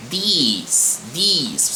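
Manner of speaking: "these" with a long tense "ee" vowel, "diiz"; careful pronunciation. Shown as a demonstration. Teacher contrasting "these" with "this", careful pronunciation. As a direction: stretch the "ee" in "these" for the students. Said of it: "ele é um som de I mesmo".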